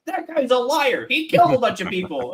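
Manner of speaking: high mocking voice for the first captive; character voice. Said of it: "They're like".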